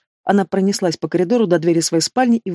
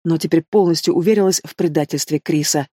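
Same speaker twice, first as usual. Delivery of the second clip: usual speaking voice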